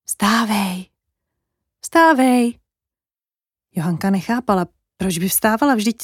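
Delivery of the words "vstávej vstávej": drawn-out wake-up call, rising on the repeat; distant call into someone's dream; voice from afar. Acted. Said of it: "jakoby z dálky ozvalo".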